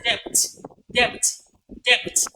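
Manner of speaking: "debt" with the silent B sounded; mispronunciation. Shown as a demonstration. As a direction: pop a B before the T in "debt". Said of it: "You can't say debt".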